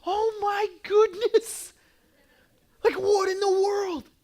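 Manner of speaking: high, drawn-out acted astonishment; awestruck amazement. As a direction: play a stunned onlooker, slowly and high-pitched. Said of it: "and you're just like".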